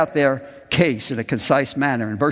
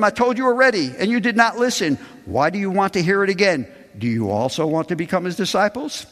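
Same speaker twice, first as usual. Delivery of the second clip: higher, defiant and pointed; bold retort; 7 semitones higher, faster than usual. Acted. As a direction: throw it back at them boldly. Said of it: "That's pretty bold".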